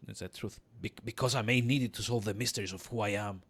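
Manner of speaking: low, soft hero voice; earnest player character. Acted. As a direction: explain yourself quietly to the midwife.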